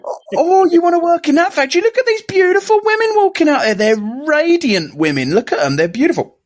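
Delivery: high, excited sales pitch voice; hammed-up high recruiter pitch; mock factory recruiter. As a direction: hype it up like a smarmy recruiter. Recruiter selling the factory job.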